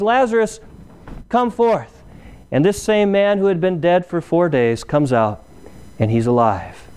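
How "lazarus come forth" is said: mild raised-pitch summons, imitating Jesus; retold calling out. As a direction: call it higher, though no louder, as a retold command. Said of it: "Jesus cries out in a loud voice".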